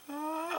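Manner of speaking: high, drawn-out overwhelmed "ah"; near-tears squeal. Retold or imitated. Fan reacting to George Romero's praise, high near-tears squeal. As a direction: imitate a tiny high wail of being moved. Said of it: "I wanted to cry".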